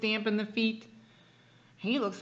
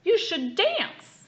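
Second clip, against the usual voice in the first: high, loud, slow porcupine; 8 semitones higher, 7 dB louder, much slower than usual. A narrator's reading. Father reading to child, high slow porcupine voice. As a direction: sing out dance slowly and high. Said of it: "That's what the porcupine said".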